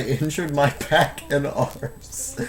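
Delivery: laughing through the words; stifled laughter. Real, not acted. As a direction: try to read on as a laugh slips out. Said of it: "I'm sorry for laughing at that".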